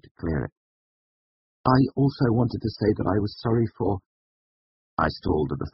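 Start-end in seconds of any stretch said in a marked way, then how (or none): 1.7-4.0 higher, halting apology; hesitant apology. Acted. Student apologising to the master, hesitant and higher. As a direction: start an awkward apology and stall.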